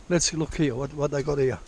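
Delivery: ordinary talk, nothing marked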